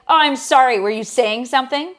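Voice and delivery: a put-on sarcastic scolding; sarcastic tour guide. Tour guide scolding chatting guests, mock-polite and loud. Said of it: "he would be like".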